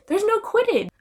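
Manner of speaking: indignant no Quidditch; outraged disbelief. Real, not acted. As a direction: protest it, scandalized. Outraged about the movies.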